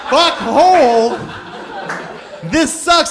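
loud, high indignant yell; mock outrage. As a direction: hurl it like a disgusted quitter. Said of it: "then he pointed at me".

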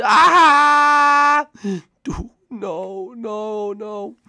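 ordinary delivery

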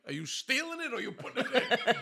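high, loud question through laughter; laughing tease. Retold or imitated. Man teases neighbour about the rug, laughing loud and high. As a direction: needle him while laughing.

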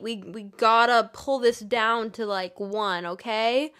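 usual speaking voice

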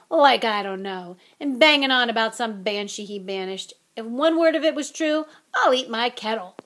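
loud, indignant scoffing; he's fed up with that fraud, let it out loud; scornful outburst. A narrator's reading. Hagrid scorning Lockhart.

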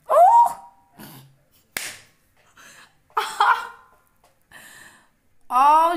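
very high, loud yelp then a laugh; a sharp cry as it rips; pained yelp. Real, not acted. Peeling the mask off, a loud pained yelp.

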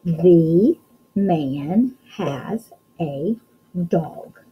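very slow, word by word; slow reading aloud. Shown as a demonstration. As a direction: draw each word out, building suspense. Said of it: "ready to read our secret sentence".

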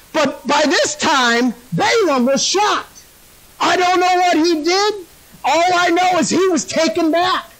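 high, stretched-out sermon emphasis; emphatic preaching. Acted. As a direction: proclaim each phrase like a fired-up preacher. Animated preaching of the story.

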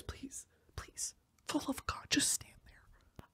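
desperate, hushed whisper; whispered pleading. Acted. Begging men to just stand there, whispered plea. Begging him to stay quiet.

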